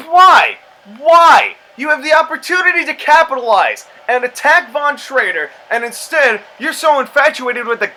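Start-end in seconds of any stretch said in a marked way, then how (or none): 0.1-4.7 high, loud exasperated "why"; exasperated yell. Real, not acted. Gamer yelling at a wrestler who won't attack. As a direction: yell at the screen in disbelief.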